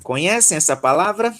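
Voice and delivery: normal talking voice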